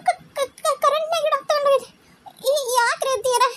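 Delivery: high, fast panicked stammer; alarmed stammer. Acted. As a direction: rush the words out in fright. Daughter-in-law hears baby taken away. (ಗಾಬರಿಯಿಂದ ತಡವರಿಸುತ್ತ, ಎತ್ತರದ ದನಿಯಲ್ಲಿ ವೇಗವಾಗಿ)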